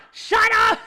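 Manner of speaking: high, loud yell; shout. Real, not acted. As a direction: yell shut up at chat. Yelling at chat.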